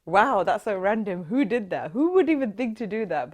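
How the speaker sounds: a stunned, loud wow at the sheer nerve; amazed disbelief. Retold or imitated. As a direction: blurt it out in wide-eyed shock. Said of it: "was just like".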